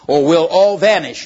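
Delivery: loud warning cry. Acted. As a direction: shout the threat. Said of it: "cried Diggory".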